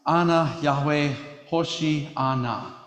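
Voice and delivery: "Ana Yahweh Hoshi Ana" read slowly, each Hebrew word sounded out; Hebrew phrase. Shown as a demonstration. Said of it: "In Hebrew, it reads".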